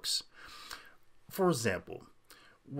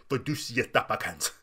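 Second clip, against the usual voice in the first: put-on Fiducia Supplicans with over-accentuated Latinate syllables; mock Latinate accent. Host mocking commentators who accentuate syllables, showy Latinate accent. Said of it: "they accentuate certain, uh, the syllables of it".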